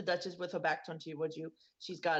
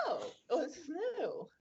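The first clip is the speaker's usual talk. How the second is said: "oh" drawn into an intrigued, teasing reaction; higher, knowing mock surprise; 9 semitones higher, slower than usual. Side-eyeing Duke spots the hand-holding, knowing surprise. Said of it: "been, like, side-eyeing you".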